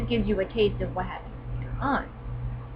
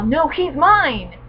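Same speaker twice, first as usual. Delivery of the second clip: slow, loud jealous claim; hammed-up jealous boyfriend; possessive claim; 5 semitones higher, 6 dB louder, much slower than usual. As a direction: stake your claim slowly. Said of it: "Kazu's all".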